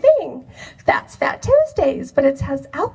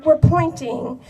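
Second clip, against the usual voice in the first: ordinary talk, nothing marked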